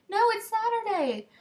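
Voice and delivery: high, loud mock correction; a mocking high imitation of the friend; imitating the friend. Friend flatly contradicts her.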